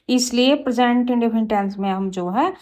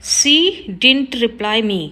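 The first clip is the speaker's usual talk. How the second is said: slow, careful read of the wrong sentence; slow teacher dictation; much slower than usual. Shown as a demonstration. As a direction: spell it out word by word for the class. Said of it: "wrong है sentence".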